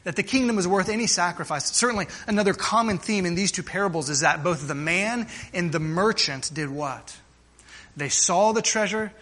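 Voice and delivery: ordinary talk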